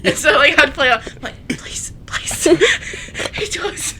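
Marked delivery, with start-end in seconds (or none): 1.5-2.4 whispered, put-on begging; mock pleading. Playing along about the wall dent, whispered mock pleading. 3.3-3.9 high, breaking fake whimper; mock tearful confession. Playing along with worried visitors, mock tearful confession.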